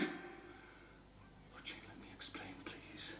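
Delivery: faint, unvoiced plea; pleading whisper. Rochester begging Jane to let him explain, whispered. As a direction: beg her almost silently.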